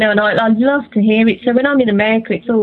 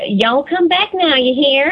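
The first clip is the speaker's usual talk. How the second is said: slow, higher-pitched Southern US accent; deep south Texas accent; 4 semitones higher than usual. Shown as a demonstration. British singer imitating Texans on interviewer's prompt, deep south accent. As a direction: put on a deep Southern drawl. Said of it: "deep south Texas or throw a y'all".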